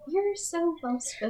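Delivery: patronizing compliment. Retold or imitated. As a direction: mimic a fake-nice compliment. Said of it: "That's my favorite one".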